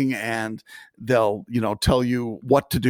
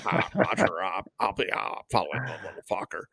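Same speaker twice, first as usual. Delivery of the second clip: airline pilot voice; 3 semitones lower, 7 dB quieter, faster than usual. Retold or imitated. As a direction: say it like a calm pilot on the radio. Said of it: "airline pilot voice".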